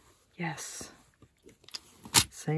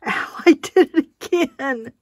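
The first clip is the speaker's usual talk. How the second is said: high, loud laughing yelp; pained laughing; 8 semitones higher, 5 dB louder than usual. Real, not acted. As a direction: laugh through a sudden ow. Pricks herself with needle again.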